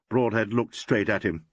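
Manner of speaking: ordinary delivery, nothing marked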